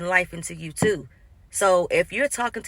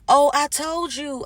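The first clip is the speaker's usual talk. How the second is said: mock insistence; 7 semitones higher, 5 dB louder than usual. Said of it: "They'll say".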